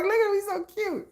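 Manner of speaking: high, soft, cutesy; mock cutesy voice. Creator voicing the squirrel with the cookie, high soft cutesy. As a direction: coo it like a cute little animal.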